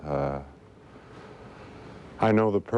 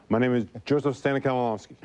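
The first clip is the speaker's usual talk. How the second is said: quick and higher, rattled off in one breath; fast name; 6 semitones higher, much faster than usual. Shown as a demonstration. Stutterer proves on air he can say his name fast, quick and higher. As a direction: fire off your full name fast and fluent. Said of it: "but I can say it fast".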